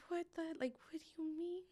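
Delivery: the world just fell away; high shocked cry. Retold or imitated. Mother to the hospital girl, high frantic disbelief. Mother hears daughter intubated.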